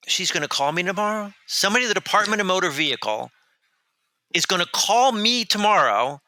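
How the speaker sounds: can't believe the DMV will call back; incredulous. Retold or imitated. Telling the host about the DMV, rising incredulous disbelief.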